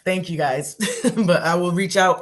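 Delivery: thanks through a laugh; relieved laughing thanks. Real, not acted. Relieved thanks after approval.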